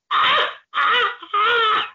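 high screeching cackle; squawking villain laugh. Retold or imitated. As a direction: squawk it out like a maniac. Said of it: "It's like a squawk".

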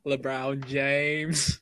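extremely slow, stretched out; very slow drawn name. Retold or imitated. Imitating a friend's brothers, very slow. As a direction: drag LeBron James out slowly. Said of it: "They're just like, "LeBron James".

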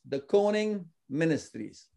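measured, deliberate announcement of a name; slowly repeated name. Shown as a demonstration. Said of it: "say that name again slowly".

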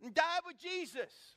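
high, loud rallying shout to companions; rallying call. Acted. Thomas urges his fellows to go, high acted shout. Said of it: "said to his fellows".

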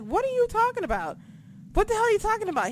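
high-pitched, disbelieving question; demanding an explanation in a high voice; incredulous retort. Retold or imitated. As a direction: act out baffled disbelief, pitch jumping up. Acting out her startled replies to friend.

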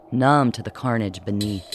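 plain reading voice

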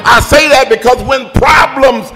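building, loud, high sermon cadence; preaching at full force. Acted. Preacher rousing the congregation, building to loud and high. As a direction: whoop it up to the climax. Preaching climax, rising intensity.

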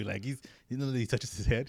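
usual speaking voice

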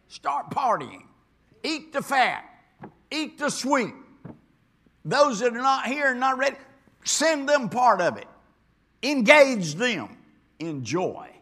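high, loud call to celebrate; rally the church into joy; fiery preacher building to a shout; preaching at full force. Acted. Preaching climax, rising to a call.

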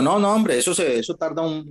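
indignant excuse, hammed up; mock protesting boss. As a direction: play the boss squirming out of it.

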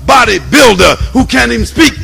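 loud, fired-up preacher; preaching at full force. Acted. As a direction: build the sermon to a shout.

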